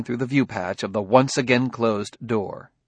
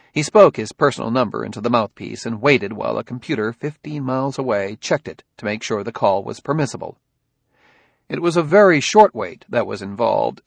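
ordinary delivery

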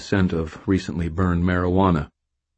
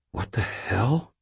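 quiet, slow spooked question; uneasy murmur; 5 semitones higher, 5 dB quieter, slower than usual. A narrator's reading. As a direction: murmur it, unnerved. Narrator spooked by presence.

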